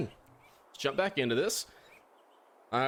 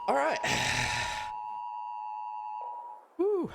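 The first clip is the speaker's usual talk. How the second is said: breathless relief. Real, not acted. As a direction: catch your breath after the chase. Relief after escape.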